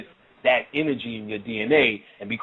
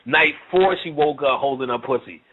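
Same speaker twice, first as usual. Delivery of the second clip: ordinary delivery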